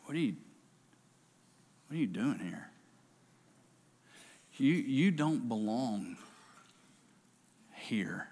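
a quiet voice on the mountain fading to a whisper; hushed voice. Acted. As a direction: let it sink to a whisper. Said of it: "listen what it said".

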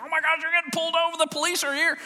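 high, fast freak-out voice; panicked kids. Retold or imitated. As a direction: squeal it like children panicking in the back seat.

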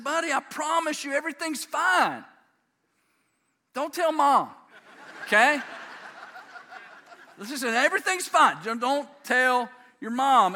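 high, loud, emphatic coaxing; insistent pleading dad. Retold or imitated. Dad swearing crying son to secrecy from Mom, acted urgent insistence. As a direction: press the child hard to keep the secret.

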